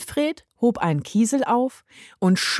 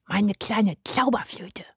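zärtliches Flüstern; flüsternd; 4 dB quieter than usual. A narrator's reading. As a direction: leise und liebevoll hauchen. Zärtlicher Kosename der Maus. (zärtlich geflüstert)

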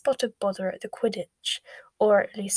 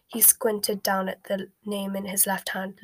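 ordinary delivery

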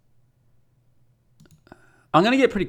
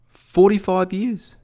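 higher, slow mocking jab; 8 semitones higher, slower than usual. Needling an Israeli at Christmas lunch, slow mocking. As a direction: draw it out high to needle him. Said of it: "I just started mocking him openly".

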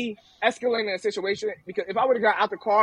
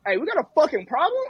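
imitating a man spoiling for trouble, pitched up; confrontational challenge; 7 semitones higher than usual. Stranger gets out of the car at him, mock confrontational.

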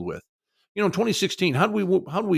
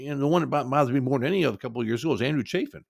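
ordinary talk, nothing marked

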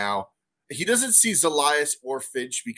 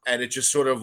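usual speaking voice

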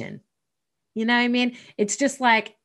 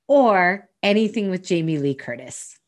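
normal talking voice

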